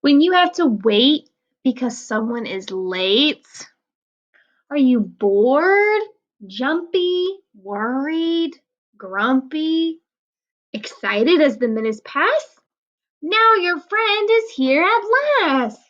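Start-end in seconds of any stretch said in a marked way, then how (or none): none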